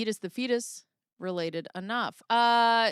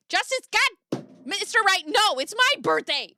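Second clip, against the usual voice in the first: excited outburst; 11 semitones higher, 6 dB louder than usual. Real, not acted. Birthday outburst on stream.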